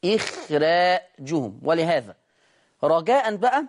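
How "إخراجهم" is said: slow إخراجهم with thin ر. Shown as a demonstration. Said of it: "لا يمنع من الترقيق".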